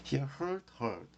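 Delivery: hear, heard, heard as a separated drill, changing the vowel; "hee-er", then "herd" twice for hear, heard, heard; demonstrated pronunciation. Shown as a demonstration. Teacher recites verb forms hear, heard, heard, careful pronunciation. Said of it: "이렇게 읽어요".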